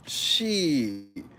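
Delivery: ordinary delivery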